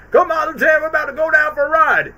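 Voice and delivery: high, loud cartoon officer shouting; mock general's yell. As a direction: yell it like a gung-ho general mid-jump. Acting out general yelling to lieutenant.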